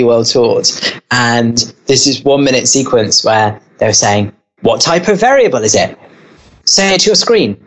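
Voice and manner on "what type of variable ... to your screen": put-on higher-pitched teacher prompting a child; lively mock teacher quizzing a pupil; imitating enthusiastic teacher.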